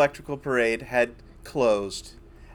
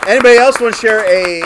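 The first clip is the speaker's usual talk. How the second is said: loud, higher call to the audience; 8 semitones higher, 11 dB louder than usual. Real, not acted. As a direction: call out for the next volunteer. Host calls out to the audience.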